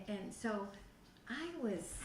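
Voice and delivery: ordinary talk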